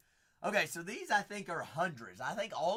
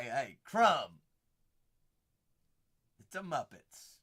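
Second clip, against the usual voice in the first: usual speaking voice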